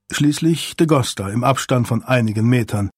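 ordinary delivery, nothing marked

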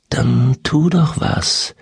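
slow, coaxing inner-voice whisper; luring spirit whisper. A narrator's reading. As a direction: tempt her slowly, half whispered. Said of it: "flüsterte die Stimme in ihrem Hinterkopf".

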